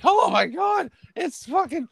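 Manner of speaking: very high falsetto squeal; mock shocked girl. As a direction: imitate a girl gasping in amazement. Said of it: "be like".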